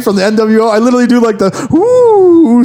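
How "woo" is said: high, loud ghostly woo with spooky fingers; imitating a wrestler's taunt; spooky mock woo. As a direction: spook him, playground style. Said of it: "spooky fingers at him".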